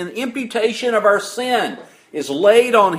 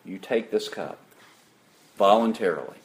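a low, much softer, solemn answer; voice of God the Father; 13 semitones lower, 8 dB quieter, faster than usual. Retold or imitated. Preacher voicing God's reply to Christ, low and hushed.

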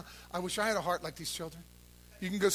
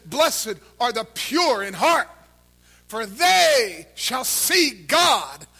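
preached scripture; 3 semitones higher, 13 dB louder, much slower than usual. Acted. Preacher proclaiming Matthew five eight to the congregation, slow shouted scripture. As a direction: proclaim each word slowly to the rafters.

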